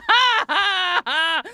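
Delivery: loud, high mock bawling; hammed-up wailing between gulping sobs; hyperventilating sobs. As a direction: re-enact sobbing over something precious. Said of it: "like, sobbing".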